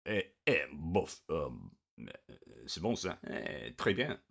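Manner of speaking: a jokey French shrug in a French accent; mock French accent. Shown as a demonstration. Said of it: "I won't do my French accent".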